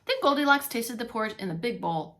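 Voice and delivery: ordinary delivery, nothing marked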